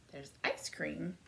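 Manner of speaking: plain reading voice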